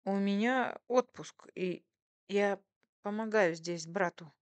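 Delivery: halting, slow, flustered explanation; stammering excuse. A narrator's reading. Waitress excuses being in the cafe, stammering. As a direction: explain yourself nervously in broken pieces. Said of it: "оправдывалась она, заикаясь".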